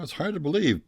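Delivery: a hint of a German scientist amazed; German accent. Scientist marvels at the reindeer in light German accent. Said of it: "he said in his, uh, thick German accent".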